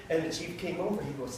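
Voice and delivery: ordinary talk, nothing marked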